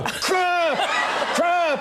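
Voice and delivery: very high mock holler; calling from a window. Kid shouting from the window at departing actor, high yell. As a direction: yell it after the car, hammed-up. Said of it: "and he opens up the window".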